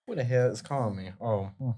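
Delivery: ordinary delivery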